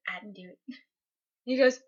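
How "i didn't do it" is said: very soft, small-voiced protest; meek denial. Student answers principal who called his name, meek denial. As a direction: deny it timidly, hardly audible.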